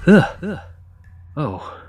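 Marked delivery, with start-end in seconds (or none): 0.1-0.6 sudden loud startled grunt, higher. Acted. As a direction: jolt back with a sharp, loud ugh. Startled at meeting the monster.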